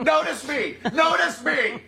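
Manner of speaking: high, laughing cry for attention; mock yelling. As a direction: imitate an attention-seeking shout, amused. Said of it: "It also expresses volume".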